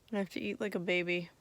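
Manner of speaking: normal talking voice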